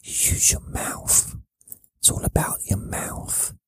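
slow, soft mouth-only whisper. Shown as a demonstration. Teaching viewers how to whisper, whispered. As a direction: whisper it using just the mouth. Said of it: "when you whisper".

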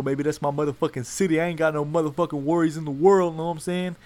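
cocky hype. Acted. Hyping his home city.

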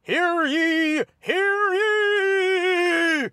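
high, sung-out proclamation call; town crier. Pretend. Podcast intro opening call.

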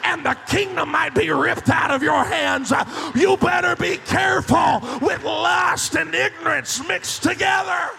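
loud, rising pulpit preaching; fiery sermon voice, slowing on the last lines; full-force preaching. Acted. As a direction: preach it to the back row.

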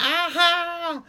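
high, loud mock-excited "aha!"; imitating a giddy, hopping celebration; excited whoop. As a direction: whoop like an overexcited bull rider. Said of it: "He got really excited".

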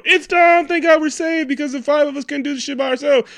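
very high, loud mock relief; hammed-up movie characters. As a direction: shriek it as an over-the-top rescued sidekick. Acting out movie characters in climax.

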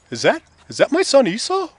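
put-on high-pitched, unsure old dad voice; puzzled old father. Blind father asking if it's his son, high and puzzled. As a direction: question who has just come in.

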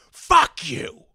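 high, loud mock yell; angry shout. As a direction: shout it defiantly. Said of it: "He should have just been like".

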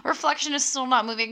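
ordinary talk, nothing marked

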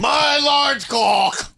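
slow booming announcement; drawn-out shout. Pretend. Joke blurt instead of question.

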